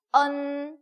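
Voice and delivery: 언 held long and loud; loud, stretched model 언; blending sounds. Shown as a demonstration. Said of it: "엔 합쳐지면".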